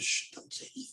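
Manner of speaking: hushed whisper, almost no voice; urgent hushed whisper to keep quiet. Retold or imitated. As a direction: whisper it so no one at the door hears. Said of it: "We said".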